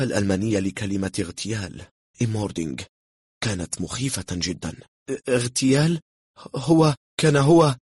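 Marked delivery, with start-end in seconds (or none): 5.1-7.8 high, loud, stumbling disbelief; shaken stammer. A narrator's reading. German guest hears of the assassination, shaken stammer. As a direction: stutter in alarm, voice pitched up. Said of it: "ارتعد الألماني".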